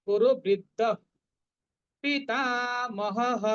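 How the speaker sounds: পিতামহঃ ending in a clear h; visarga as h. Shown as a demonstration. Teacher showing the visarga sound in পিতামহঃ. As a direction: end পিতামহঃ on a breathy h. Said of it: "হ এর মতো উচ্চারণ করব".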